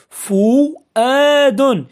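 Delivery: فؤاد very slow, with long-held vowels; slowly sounded out. Shown as a demonstration. Teacher recapping the example word, فؤاد slowly sounded out. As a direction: stretch out the syllables of فؤاد.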